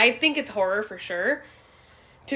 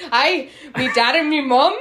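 squeaky hammed-up Irish, much higher and louder; mock Irish accent; 5 semitones higher, 8 dB louder than usual. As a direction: play the leprechaun, laughing.

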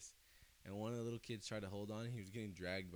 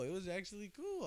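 usual speaking voice

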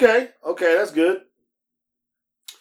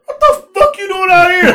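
much higher, loud, laughing disbelief; incredulous rider; 10 dB louder than usual. Retold or imitated. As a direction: mimic the shocked rider in a high, loud voice. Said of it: "They're like".

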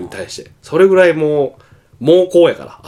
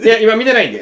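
laughing; 8 semitones higher, 4 dB louder, faster than usual. Retold or imitated. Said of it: "って言われて、こう閉めた時の".

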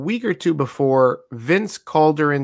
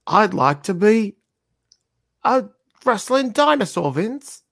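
a higher, lightly put-on voice pitching an idea; mock eager pitch; 7 semitones higher than usual. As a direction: pitch it like a nervous wrestler to the boss. Acted pitch to the boss.

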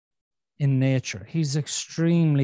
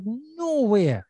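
indignant no way; 8 semitones higher than usual. Retold or imitated. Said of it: "My brain ... was like".

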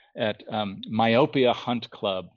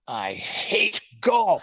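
high, very slow sneer of disgust; disdainful; 10 semitones higher, much slower than usual. Retold or imitated. Designer friend scorning the golf book idea, slow high disdain. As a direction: drawl it out, nose turned up at golf. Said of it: "like, turned up nose".

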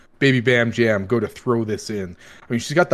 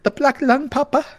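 much higher, squeaky and silly; 4 dB louder than usual. Acted. GM jokes in squeaky voice.

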